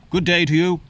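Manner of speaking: jaunty mock English hello; English accent. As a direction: greet them like a proper Englishman. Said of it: "an English one".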